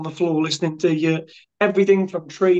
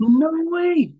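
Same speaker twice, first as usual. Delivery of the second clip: disbelief; 11 semitones higher than usual. Retold or imitated. Disbelief at the Christmas card.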